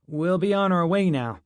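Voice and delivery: placating. A narrator's reading. Gil placates an armed guard.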